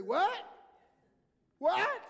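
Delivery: very loud, high questioning yell; wife calling "what?". Acted. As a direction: shout it as if you can't hear. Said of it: "She would say".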